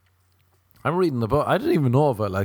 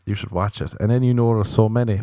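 ordinary talk

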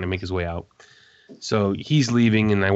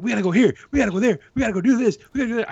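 much higher, hurried orders; mimicking eager Legends; 13 semitones higher, 4 dB louder, much faster than usual. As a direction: rattle off plans like the hyper rookie.

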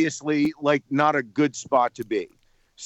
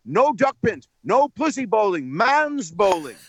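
higher, emphatic rock-star impersonation; 7 semitones higher than usual. Retold or imitated. Rock star rejecting duck pins, high and insistent. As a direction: correct them firmly, stretching the final phrase. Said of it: "And he, and he said".

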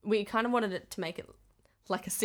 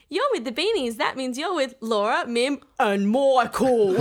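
loud, exaggerated mock Australian accent; kids' accent impression; 5 semitones higher, 7 dB louder, slower than usual. Performer mimicking American kids copying the opening, exaggerated Australian accent. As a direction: chant it high, stretching the last name. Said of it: "their kids doing their impression of our opening".

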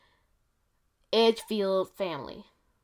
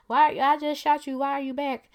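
high, louder disbelieving cry; mock incredulous shout; shocked outrage; 7 semitones higher, 5 dB louder than usual. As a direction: sell the family's shock that bullets failed. Family shocked shooting didn't work.

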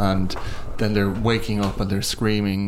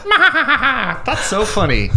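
much higher, laughing through the words; nervous laughter; 13 semitones higher, 4 dB louder, much slower than usual. Retold or imitated. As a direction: giggle it out, half-dazed. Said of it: "It's nervous laughter".

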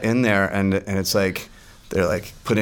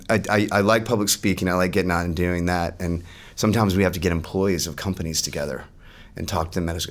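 usual speaking voice

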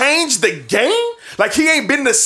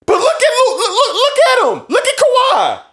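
urgent, higher, pointing it out; excited higher calling; 10 semitones higher than usual. Real, not acted. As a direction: point at him, fired up.

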